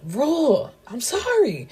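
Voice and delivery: a sing-song, teasing "sorry" to her new husband; playful apology. Retold or imitated. Bride apologising to Rule after teasing him, high playful tone.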